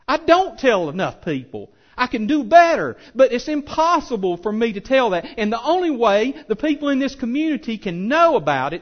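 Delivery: high, loud full-force preaching, building and pounding; fiery preaching. Acted. Pastor urging his congregation to spread the word, shouting with fervour. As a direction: preach it out to the whole room with fire.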